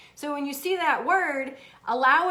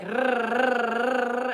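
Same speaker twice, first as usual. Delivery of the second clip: imitated cat purr. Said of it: "She's like".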